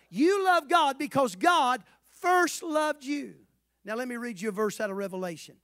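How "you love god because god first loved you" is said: louder, slow, stressing first; emphatic slow proclamation. Shown as a demonstration. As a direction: drive the word first home. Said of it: "Somebody say first loved".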